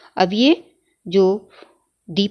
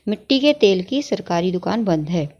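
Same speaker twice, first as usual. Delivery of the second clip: normal talking voice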